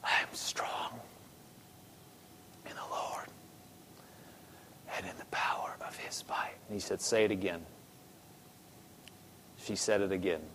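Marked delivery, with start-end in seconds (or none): 0.0-6.6 weak, halting whisper with long pauses; faint, effortful whispered confession; soft whisper. Retold or imitated. 7.0-7.7 very soft, low voice; soft-spoken coaxing; gentle prompting. Retold or imitated. Minister coaching the whispering woman.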